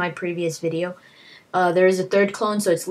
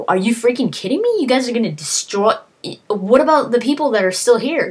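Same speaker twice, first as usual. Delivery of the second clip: outraged disbelief; 6 semitones higher, 5 dB louder than usual. Retold or imitated. Snake outraged at the bombing.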